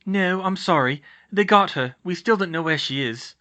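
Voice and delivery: put-on earnest, sorry girl; Hermione, apologetic. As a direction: break sad news regretfully. Voices Hermione.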